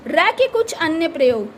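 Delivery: ordinary talk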